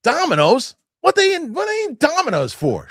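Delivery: very high, loud mock teen outrage; judgy daughter. Father imitating daughter judging neighbors' pizza, high squeal. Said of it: "she goes".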